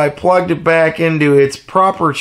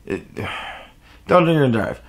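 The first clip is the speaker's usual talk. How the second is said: sighing, softer and heavier, pleading; weary sigh; 5 dB quieter than usual. Shown as a demonstration. Vlogger begging viewers not to drink and drive, with a sigh. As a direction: let it out like you're tired of saying it.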